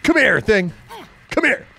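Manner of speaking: high, loud shout at fleeing prey; chasing shout. Real, not acted. Gamer chasing a victim, high shouting. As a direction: shout after someone getting away.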